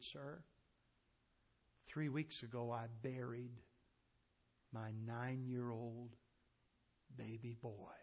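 soft, slow, grief-heavy telling; grieving mother. Retold or imitated. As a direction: hush down as she speaks of her buried boy. Grieving mother tells of dead son.

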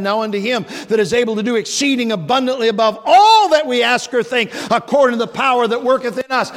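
loud, high preaching, full force; fiery preaching. Acted. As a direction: preach it out to the congregation.

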